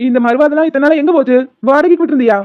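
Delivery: loud teasing. Acted. Friend loudly mocks his sudden respect. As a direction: needle him loudly.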